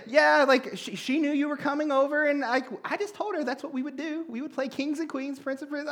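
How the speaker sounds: lightly voiced whiny kid; mock friend's excuse. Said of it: "It's like".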